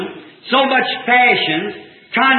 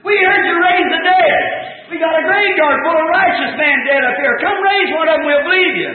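mocking taunt; 5 semitones higher than usual. The priest's taunt repeated.

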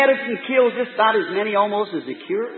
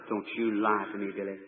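very low, hushed retold reproof; quiet stern warning; 12 semitones lower, 14 dB quieter than usual. Father confronting his son about smoking, quietly stern. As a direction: drop far down in pitch and volume, firm.

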